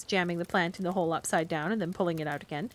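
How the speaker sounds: usual speaking voice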